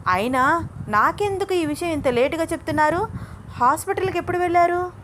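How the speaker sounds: high-pitched, excited demand; worked-up complaint at being told late; indignant questioning. A narrator's reading. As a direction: press for answers, pitched high. Granddaughter demands why told late.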